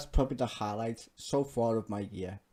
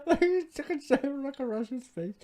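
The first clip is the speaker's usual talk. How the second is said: laughing talk; 14 semitones higher, 4 dB louder than usual. Real, not acted. Laughing at the clip.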